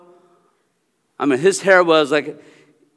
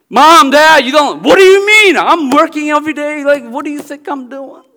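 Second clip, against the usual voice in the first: much higher, louder indignant retort; angry parents; 11 semitones higher, 6 dB louder than usual. Retold or imitated. Immigrant parent answering a child who questions their love, indignant raised voice. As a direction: play the offended immigrant parent firing back. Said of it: "they get angry".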